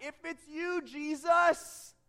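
high, urgent call across the water; yelling back. Acted. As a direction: yell back to someone far off, peaking on the name. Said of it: "Exhausted and terrified, Peter yells back".

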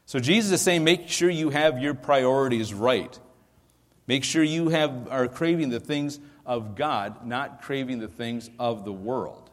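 rising, emphatic preacher's urging; preaching. Acted. Pastor urging his flock, in emphatic preaching. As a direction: press the congregation to set priorities.

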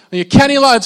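loud, high call of the name. Retold or imitated. Fan calling to a famous player, loud call. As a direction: call out again, hoping he turns. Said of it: "you call out their name".

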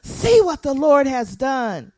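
loud, slow, fervent proclamation; passionate excitement. Acted. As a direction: proclaim it loudly, full of passion. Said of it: "full of excitement".

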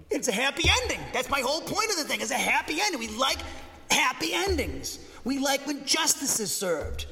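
high, loud, joyful emphasis; excited preaching. Real, not acted. Preacher urging congregation on happy endings, loud and high. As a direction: press the point with joy.

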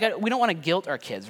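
normal talking voice

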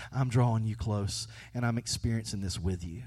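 soft, close and gentle; tender. Pretend. Jesus comforting the grieving, softly and tenderly. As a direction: draw them near with a quiet voice. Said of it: "he says".

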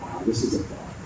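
hushed aside. Acted. Pastor hushes for emphasis.

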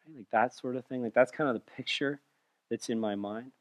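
hushed pastor picturing comfort; soft, tender sermon voice. Acted. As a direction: speak it gently like cuddling up close.

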